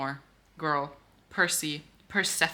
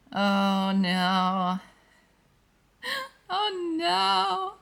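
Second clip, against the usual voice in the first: high, squeaky gasp of dismay, oh no; shocked oh no; 11 semitones higher, 4 dB louder, much slower than usual. Real, not acted. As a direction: recoil from the page in horror. Shocked at proposal in book.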